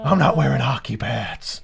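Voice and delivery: slow gravelly Batman growl. Acted. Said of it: "I'm, like, destroying my throat".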